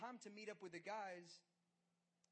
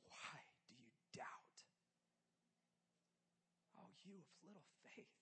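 gentle whisper; 10 dB quieter than usual. Retold or imitated. Jesus quietly asking the disciples why they doubt. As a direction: breathe the question softly.

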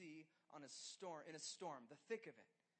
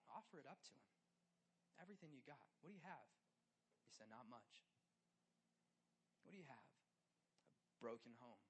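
soft appeal; 6 semitones lower, 10 dB quieter than usual. Acted.

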